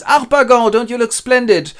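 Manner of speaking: high, loud effusive greeting; gushing flattery. Acted. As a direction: flatter with over-the-top delight. Matchmaker flatters the miser.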